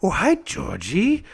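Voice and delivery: slow, lilting clown greeting; playful sing-songy Pennywise. Retold or imitated. Clown luring little Georgie, playful and sing-songy. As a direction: disarm a little kid with drawn-out sing-song. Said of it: "he has this sort of sing-songy quality in his voice".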